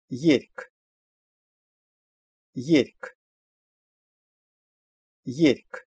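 "Ерьк" with final g sounded as k; teacher's careful "Ерьк", ending on a separate k; demonstrated pronunciation. Shown as a demonstration. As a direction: model "Ерьк", releasing a k after r. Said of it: "произносится «к» после согласной «р»".

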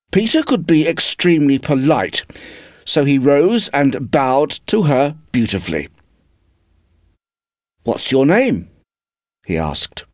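lively storybook reading with voiced characters; story narration. A narrator's reading. Reader telling Wendy and Peter's meeting in storybook voice. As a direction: read it like a bedtime story for a class.

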